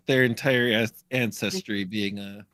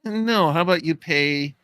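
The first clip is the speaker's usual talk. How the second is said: higher, louder comeback; indignant retort; 6 semitones higher, 5 dB louder than usual. Acted. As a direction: push back hard with a firm no. Acted retort to quote.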